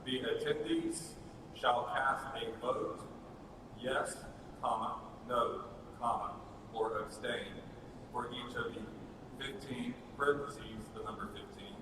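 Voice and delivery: dictating. Shown as a demonstration. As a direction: spell it out for the typist. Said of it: "can we just start typing?".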